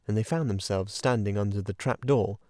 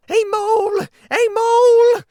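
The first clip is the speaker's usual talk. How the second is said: very high, very loud yelling; war cry; 12 dB louder than usual. A narrator's reading. Mole charging the weasels, screaming his war cry. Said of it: "shouting his awful war cry".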